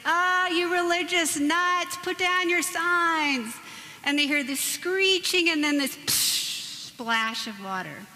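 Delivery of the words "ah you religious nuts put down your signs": jeering driver. Retold or imitated. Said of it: "he says".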